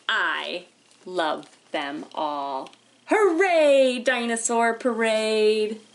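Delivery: slow, lilting picture-book read with long pauses; reading to children. Acted. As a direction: read it like storytime for little kids. Teacher reads picture book aloud.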